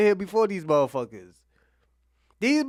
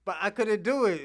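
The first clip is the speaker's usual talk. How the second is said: usual speaking voice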